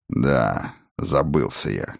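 low, soft admission; subdued admission. A narrator's reading. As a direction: admit the lapse quietly. Муж признаёт оплошность. (тихо, виновато, низким голосом)

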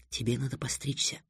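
very soft murmur into the ear; whispered. A narrator's reading. Тиана whispers in Трикс's ear, interrupting his musings, hushed. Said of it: "шепнула Триксу на ухо Тиана".

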